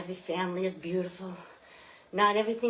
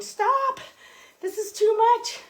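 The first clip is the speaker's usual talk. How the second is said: much higher, louder mock protest; body crying stop; 14 semitones higher, 7 dB louder, slower than usual. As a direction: shout like a body begging to stop. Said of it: "if it's saying".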